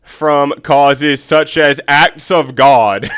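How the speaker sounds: slow, loud, drawn-out disbelief; mocking the legal text, slowly and loudly; mock incredulous reread. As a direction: drag the words out loud like it's absurd. Mock-reading the legal clause in disbelief.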